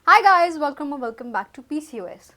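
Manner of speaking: bright high-pitched "hi guys", then settling; imitating an upbeat vlog opener; retold intro greeting. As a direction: re-enact your own video-opening greeting. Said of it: "मैंने पता है क्या बोला".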